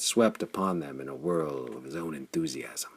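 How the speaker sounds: usual speaking voice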